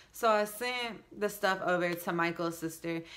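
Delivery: ordinary talk, nothing marked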